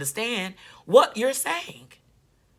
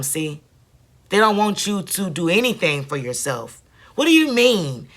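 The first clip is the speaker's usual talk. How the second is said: ordinary talk, nothing marked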